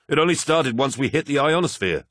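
flight officer voice. Acted. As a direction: voice a tense, hurried officer.

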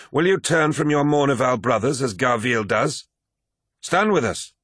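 rally him, pitch climbing to the command; urgent appeal. Acted. Abaddon asks Tarik to stand with him, urgent.

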